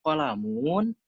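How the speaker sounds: kolamun high in a Sundanese lilt, last vowel stretched; Sundanese accent. Retold or imitated. Imitating Sundanese people reading Arabic, Sundanese-accented kolamun. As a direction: mimic a Sundanese reader, rising long on -mun. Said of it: "Orang Sunda ya".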